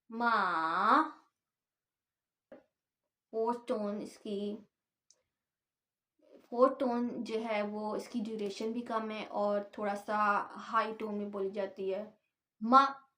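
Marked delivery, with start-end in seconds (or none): 0.1-1.0 मा dipping down then rising; third tone. Shown as a demonstration. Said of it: "इसको ऐसे pronounce करते हैं". 12.6-13.0 मा short and falling sharply from high; fourth tone. Shown as a demonstration. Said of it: "इस तरह pronounce करेंगे".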